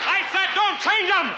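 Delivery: high, slow, hammed-up yell; mock furious shout. Comedian yelling at the light crew, mock furious shout. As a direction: bellow it at the lighting guy in fake rage. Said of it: "you asshole".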